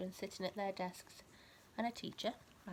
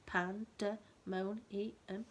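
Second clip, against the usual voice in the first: Pandemonium in five slow separate beats, each syllable clapped; clapping out syllables; much slower than usual. Shown as a demonstration. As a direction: say Pandemonium very slowly, one clap per syllable. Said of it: "Shall we clap it?".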